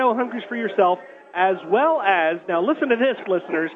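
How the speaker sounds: upbeat radio promo read; radio announcer. Acted. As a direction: sell the giveaway to listeners.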